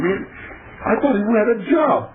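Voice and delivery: normal talking voice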